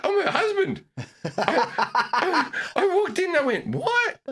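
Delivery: very high-pitched, incredulous outburst; mock shock. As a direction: squeal it in comic disbelief. Retold indignant shock at overhearing wife.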